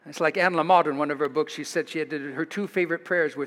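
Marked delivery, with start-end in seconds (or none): none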